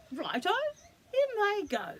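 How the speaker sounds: loud and rising into a high cheer; eager. Acted. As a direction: cheerfully throw it in. Dingo eagerly agrees.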